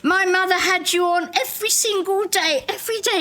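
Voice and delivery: high, eager Irish chef voice; a lightly done Irish accent pitched high. Retold or imitated. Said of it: "he had an Irish accent".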